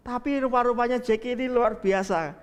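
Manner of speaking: ordinary talk, nothing marked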